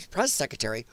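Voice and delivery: quietly fix it to "press secretary". Real, not acted. Host quietly correcting his own slip on "press secretary", careful.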